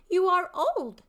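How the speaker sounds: high, loud, eager youth; young man's voice. Shown as a demonstration. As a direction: call out to the old father, high and loud. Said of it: "three distinct character voices".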